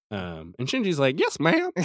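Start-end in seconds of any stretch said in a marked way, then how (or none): 1.2-1.7 high squeaky cartoon voice for Shinji; a high Mickey Mouse-style squeak; squeaky mock voice. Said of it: "Why is he giving the Mickey Mouse voice?".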